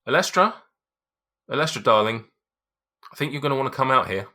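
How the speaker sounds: high, loud call over the shoulder; calling back into the house. Acted. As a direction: shout for his wife from the doorway. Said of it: "calls behind him".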